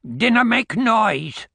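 put-on slow high growl of a grumpy old man; growled reply. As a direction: growl it like a sour old Scot. Old man grumbles at guest.

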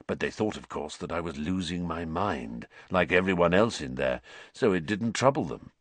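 low, weary escaped prisoner; quiet, haunted man telling his story; low Sirius voice. A narrator's reading.

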